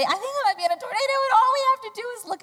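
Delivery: high, rushed, panicky voice; mock panic. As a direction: squeak it out fast to convince someone. Acting out a panicked convincing.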